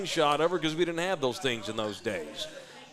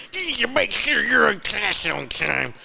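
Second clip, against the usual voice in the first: put-on high, lisping old prospector voice; 6 semitones higher than usual. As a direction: talk like your tongue won't move. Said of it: "I sound like an old prospector".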